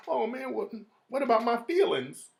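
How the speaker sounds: high, soft wounded complaint; mock emotional. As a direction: imitate someone with hurt feelings. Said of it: "You ain't supposed to get emotional".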